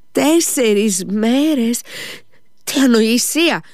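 drawn-out, high-pitched disbelief; high, stretched question, then a scoffing exclamation; incredulous exclamation. A narrator's reading. Lise hears Alyosha will stay away four days, incredulous. Lise reacts to the announced absence.

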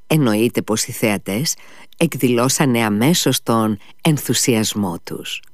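usual speaking voice

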